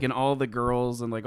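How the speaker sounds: ordinary talk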